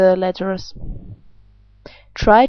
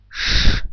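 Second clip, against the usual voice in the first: a long breathy hiss of "sh"; voiceless sh sound. Shown as a demonstration. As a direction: hiss out a plain "sh".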